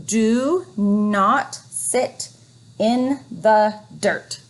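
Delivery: teacher modelling slow reading; slow reading aloud. Shown as a demonstration. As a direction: Do not sit in the dirt read very slowly. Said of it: "modelling smooth reading".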